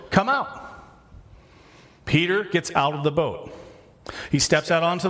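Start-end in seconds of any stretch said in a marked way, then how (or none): 0.1-0.6 calling out high across the water; mock yell. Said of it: "he yells and tells to the boat".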